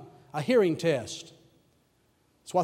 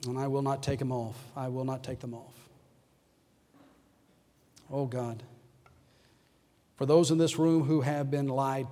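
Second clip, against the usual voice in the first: pastoral prayer; 3 semitones lower than usual. Acted. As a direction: pray gently over the room. Leads closing prayer.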